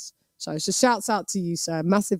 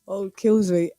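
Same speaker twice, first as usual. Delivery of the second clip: ordinary talk, nothing marked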